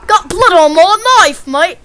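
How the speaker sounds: loud, high mock-Australian holler; broad Aussie accent at full volume. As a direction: belt it out in a hammy Australian accent. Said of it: "try to do an Australian accent".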